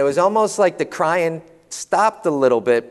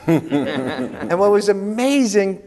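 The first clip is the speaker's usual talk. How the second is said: laughing; 5 semitones higher, much slower than usual. Real, not acted. Laughing, recalling the daughter story.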